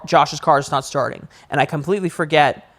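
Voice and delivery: ordinary delivery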